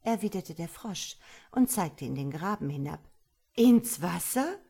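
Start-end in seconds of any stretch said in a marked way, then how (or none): 3.5-4.6 high, loud amazed question; can't believe it, bird; astonished starling. A narrator's reading. (hoch und laut, erstaunter Star)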